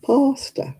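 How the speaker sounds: pasta with a long open posh London a; posh London accent. Shown as a demonstration. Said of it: "That sounds like a posh London person".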